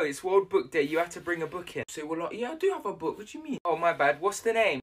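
ordinary delivery

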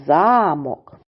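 "Замок" stressed on За-, ZA-mok; stress on first syllable. Shown as a demonstration. Teacher naming a wooden or stone building, stress on first syllable. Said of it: "припадає на перший склад".

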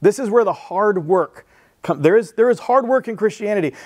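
ordinary delivery

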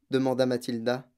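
ordinary delivery, nothing marked

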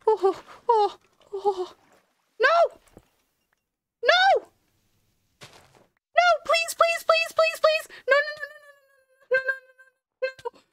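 very high shrieked pleas, fading out; panicked screaming. Real, not acted. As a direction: shriek and beg as you lose it. About to die in game.

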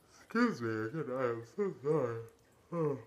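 high-pitched, yawn-stretched apology; real yawn swallowing the words; talking through a yawn. Real, not acted. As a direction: try to apologize mid-yawn. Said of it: "I keep yawning".

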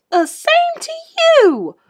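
high, slow, squeaky little hamster voice; rude squeak. A narrator's reading. Hamster squeaking back rudely at his teacher. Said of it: "I squeaked back rudely".

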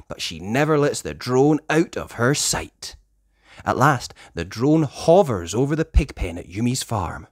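ordinary delivery, nothing marked

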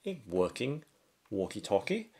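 ordinary talk, nothing marked

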